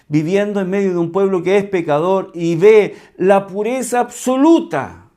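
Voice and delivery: preacher building to a climax; fervent preaching. Acted. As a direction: drive home God's purity.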